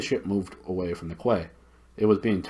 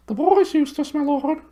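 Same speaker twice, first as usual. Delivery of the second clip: very high owl voice, hooted loudly; high, loud owl call; loud hooting owl; 8 dB louder than usual. Acted. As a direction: hoot it as loud as you can. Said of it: "hooted the owl as loud as it could".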